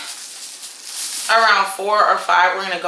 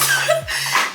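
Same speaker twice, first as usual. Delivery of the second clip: high laugh. Real, not acted. Laughing at own joke.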